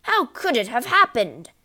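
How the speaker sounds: loud, high demand; baffled yell. A narrator's reading. Father demanding answers from family, yelling. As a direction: yell it at everyone. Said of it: "he yelled".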